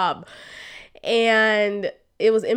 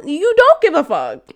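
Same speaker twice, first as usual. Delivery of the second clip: louder, higher, biting indignation; indignant outburst; 7 semitones higher, 8 dB louder than usual. Real, not acted. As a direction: spit it out, fed up and incredulous. Ranting about his empty words.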